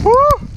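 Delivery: high loud whoop; cheering woo. Real, not acted. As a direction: whoop at the sky. Cheering in rain.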